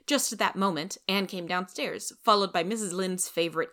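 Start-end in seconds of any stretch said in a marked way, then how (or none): none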